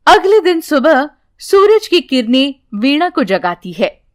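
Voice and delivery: plain reading voice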